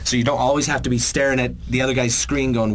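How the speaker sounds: normal talking voice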